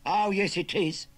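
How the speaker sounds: high, loud contradiction; insistent Cockney. Acted. Nephew overruling his uncle, insistent. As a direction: insist loudly, pitched well up. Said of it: "insisted the Cockney".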